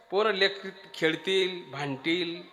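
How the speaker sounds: usual speaking voice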